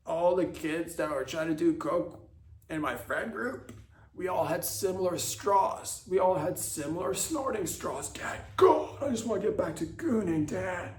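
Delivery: put-on high, pleading bratty teen; exasperated kid begging to be understood; whiny teen son. Son explains to dad.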